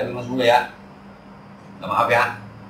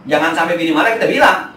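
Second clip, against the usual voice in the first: ordinary delivery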